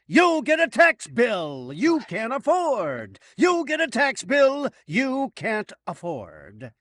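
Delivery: loud, high, drawn-out mock giveaway shout; Oprah-style yell. Host parodies the car giveaway with bad news, yelling. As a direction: bellow it like a prize announcement. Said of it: "she forgot to yell".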